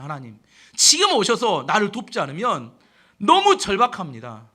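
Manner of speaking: loud, urgent plea to God; acted-out fervent supplication; desperate prayer. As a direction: cry out as if at the end of your strength. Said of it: "엎드려 하는 기도하는 겁니다".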